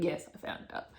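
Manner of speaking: unvoiced, soft flat reply; a whispered told-you-so; whispered deadpan. Quiet retort to boss.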